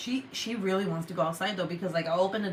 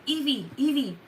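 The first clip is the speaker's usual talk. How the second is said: higher, searching call for a pet; mock panicked calling; 6 semitones higher than usual. Owner searching upstairs for her cat, panicked calling. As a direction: call out the name twice, worried. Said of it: "in a panic".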